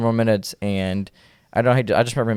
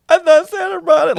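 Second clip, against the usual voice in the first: put-on much higher, louder childlike voice; little kid; 8 dB louder than usual. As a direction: whine it like a heartbroken young boy. Kid heartbroken about the broken guitar.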